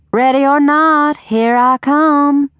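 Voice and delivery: high, drawn-out hide-and-seek call; sweet calling voice. A narrator's reading. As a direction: call out sweetly in a singsong to a hidden child. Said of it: "the sweet voice of her only parent call".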